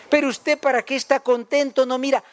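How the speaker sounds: a grumpy rich man's mock reproach; mock scolding. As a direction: scold the happy fool, hammed up. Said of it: "le regañó".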